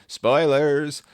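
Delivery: sudden jokey outburst, a touch hammed-up; mock spoiler alert. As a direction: shout the warning like a cheeky spoiler alarm. Blurting out a plot spoiler.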